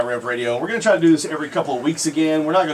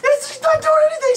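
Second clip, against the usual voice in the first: amazed shout; 3 dB louder than usual. Real, not acted. Guest still unfazed by hot sauce.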